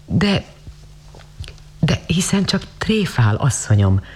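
falter, then protest with mild pain. A narrator's reading. Girl doubts the lady's invitation, pained and hesitant. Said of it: "mondta a lány fájdalmasan".

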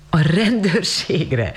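high, drawn-out amused question; amused disbelief. A narrator's reading. Lady laughs off the girl's fear, amused. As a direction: echo it slowly, as if about to laugh. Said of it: "Rosemary felkacagott".

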